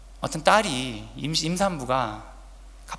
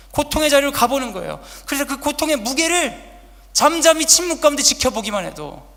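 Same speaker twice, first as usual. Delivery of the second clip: loud, higher exhortation; fervent preaching; 9 semitones higher, 11 dB louder than usual. Acted. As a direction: urge the congregation forward with force. Exhorting congregation.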